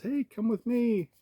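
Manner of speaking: high, slow invitation; high-voiced character greeting; art student voice. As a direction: lift the pitch for a friendly invite. Voicing a friendly art student.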